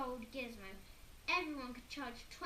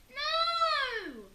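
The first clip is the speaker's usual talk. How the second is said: very high, loud scream; both boys screaming no; 13 dB louder than usual. A narrator's reading. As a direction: scream in full refusal. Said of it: "screamed Henry and Peter".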